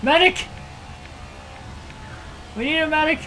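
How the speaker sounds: high mock battlefield yell for a medic; medic call. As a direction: call for the medic like a wounded soldier.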